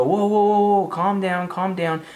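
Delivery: imitated friends urging calm; calming him down. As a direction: settle down a hothead. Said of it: "They go".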